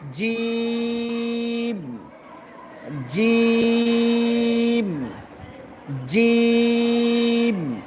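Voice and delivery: slow chanted letter name জিম; long drawn-out jim. Shown as a demonstration. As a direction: stretch জিম into one long held note. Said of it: "এটা অনেকেরই উচ্চারণ হয় না".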